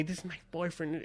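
hushed imitation of silent mouthing; mouthed, barely voiced. Woman mouthing to him across the dance floor, hushed. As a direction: drop right down as if mouthing it. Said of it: "mouths to me".

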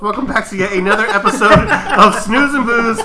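podcast host intro. Acted.

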